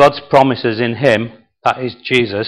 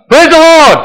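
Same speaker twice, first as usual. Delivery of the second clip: very high, loud cry; shout; 9 dB louder than usual. Acted. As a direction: shout it at full pitch. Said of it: "And he shouts".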